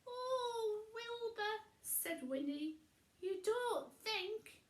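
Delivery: high hesitant witch voice; dawning realisation, high; high, slow unsure witch; Winnie voice. A narrator's reading.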